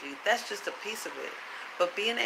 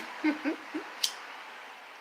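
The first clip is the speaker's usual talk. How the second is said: airy giggle; 10 semitones higher, 5 dB quieter than usual. Real, not acted. Laughs at own mockery.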